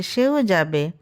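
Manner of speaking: usual speaking voice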